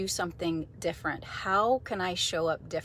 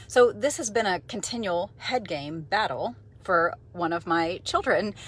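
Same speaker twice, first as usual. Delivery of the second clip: normal talking voice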